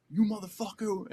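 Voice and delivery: high-pitched mock yell from afar; imitated angry yell. Shouts overheard round the corner, acted.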